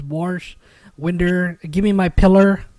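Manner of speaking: imitated Kentucky "Warsh, winder, pillar" with r sounds; wife's Kentucky pronunciation. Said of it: "She says".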